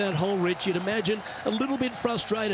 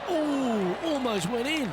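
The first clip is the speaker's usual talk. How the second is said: excited; 9 semitones higher, slower than usual. Real, not acted. Near miss on a golf shot.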